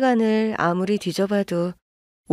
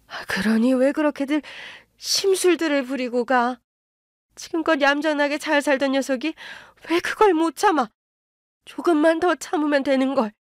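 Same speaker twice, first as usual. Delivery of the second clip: a lightly voiced reproachful mother-in-law, pitched higher; blaming mother-in-law; 6 semitones higher than usual. Mother-in-law blames them. (나무라는 시어머니, 조금 높은 목소리)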